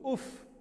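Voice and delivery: أف as "uff", no final vowel; sukun. Shown as a demonstration. Teacher demonstrating sukun to students, a vowelless "uff" for أف. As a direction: stop أف dead on the f. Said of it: "السكون".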